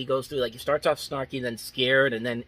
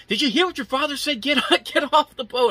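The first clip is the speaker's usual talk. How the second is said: much higher and louder mock shriek, a laugh breaking through; hammed-up frantic mom shouting her kid off the boat; panicked mother's yell; 13 semitones higher, 7 dB louder than usual. Wife yelling at her son to leave the lake, shrill mock panic. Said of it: "all of a sudden she's just like".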